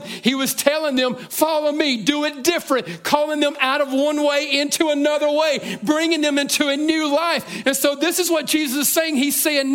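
high, loud, driving preacher's build; full-force preaching. Acted. Preaching climax, pitch rising.

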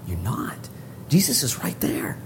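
soft whisper of comfort; hushed reassurance. Real, not acted. Preacher to the isolated listener, hushed reassurance.

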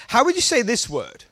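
ordinary talk, nothing marked

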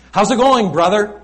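loud, high cheery hello; hearty greeting. Retold or imitated. Preacher mimics a cheery churchgoer's loud hello. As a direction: greet him with a big hearty call. Greeting the grieving British man.

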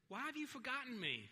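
loud, high cry of protest; anguished complaint. Acted. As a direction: demand an answer from God. Acts out psalmist's complaint to God.

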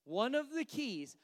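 usual speaking voice

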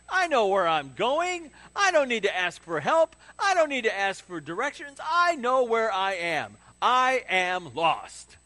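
loud, slow, proud husband who won't ask directions; mock stubborn guy. Pastor mocks husbands who refuse to ask directions, loud and proud. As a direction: ham up a stubborn man who insists he knows the way. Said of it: "Guys are like".

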